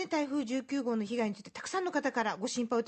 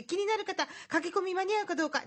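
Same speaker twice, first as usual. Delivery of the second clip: normal talking voice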